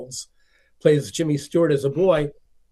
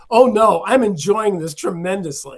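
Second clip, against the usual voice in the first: gushing delight; 5 semitones higher, 5 dB louder than usual. Retold or imitated. Customer gushing over the story.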